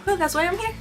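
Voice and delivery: usual speaking voice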